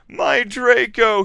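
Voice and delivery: high, slow, loud wail; moaning. A narrator's reading. Vampire crying over Draco, high drawn-out moan. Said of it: "he moaned".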